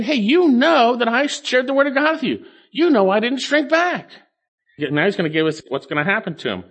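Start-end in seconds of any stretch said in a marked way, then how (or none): 0.0-4.1 raised, insistent paraphrase of an apostle; emphatic appeal. Retold or imitated. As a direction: press the point home, pitched high. Preacher voices Paul's emphatic appeal.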